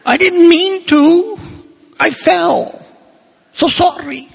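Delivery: high, fast, pleading whine; whiny parishioner. Retold or imitated. Parishioner confessing love for his secretary to the priest, high pleading whine. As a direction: plead with the priest like a guilty child. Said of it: "why are we talking like that".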